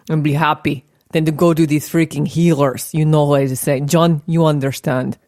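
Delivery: lightly put-on Russian accent, broken English; mock Russian accent. Shown as a demonstration. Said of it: "So we start with Russian accent".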